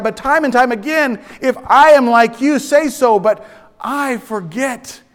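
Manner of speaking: loud, high sermon voice; fervent preaching. Acted. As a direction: drive the point home.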